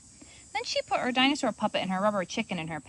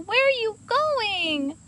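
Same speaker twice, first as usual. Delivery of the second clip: calling out; 12 semitones higher, 4 dB louder, slower than usual. Acted. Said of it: "shouted Kendall".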